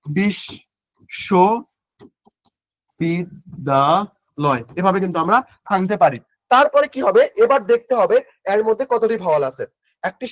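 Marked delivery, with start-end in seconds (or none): 0.0-4.6 বিশ, শো, বিদ্যালয় said apart with long pauses, the last drawn out slowly; slow syllable-split demo of বিশ শো বিদ্যালয়. Shown as a demonstration. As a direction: break বিশ শো বিদ্যালয় into spoken chunks, slowly. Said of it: "SMS এর ভাষা যদি আমরা লিখি কিভাবে".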